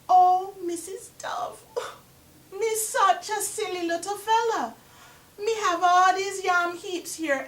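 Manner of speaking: high, sweet wheedling trickster voice; butter her up like a sly spider; 1 wheedling Anansi. Acted. Anansi flatters and asks Dove.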